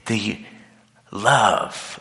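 usual speaking voice

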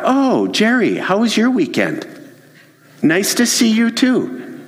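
acted office pleasantries, lifted pitch; friendly small talk. Shown as a demonstration. Coworker greeting Jerry before asking for a file, friendly.

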